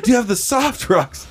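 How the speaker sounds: high-pitched innocent question; high silly ask. Retold or imitated. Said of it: "I was like".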